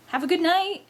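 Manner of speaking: high, eager farewell. Acted. Friend eager to get out of the house, high-pitched. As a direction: chirp it out, keen to escape. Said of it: "he said, eagerly to get it out".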